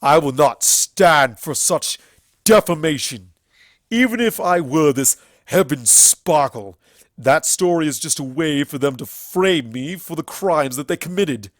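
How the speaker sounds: furious, unbending defiance; shouting. A narrator's reading. Everfree defying the princesses, shouting. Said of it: "Everfree shouted".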